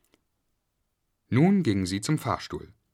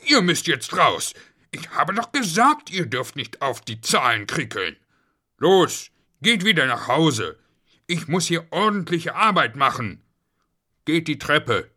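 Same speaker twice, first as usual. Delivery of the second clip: higher, louder telling-off; exasperated parental scolding; cross father; 6 semitones higher, 5 dB louder than usual. A narrator's reading. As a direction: order the children out crossly. Father sends disobedient children home.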